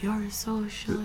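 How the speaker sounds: hushed, softly planted suggestion; imitating a soft subliminal voice; subliminal message. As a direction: slip it in quietly, like a subliminal message. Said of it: "sends me subliminal messages".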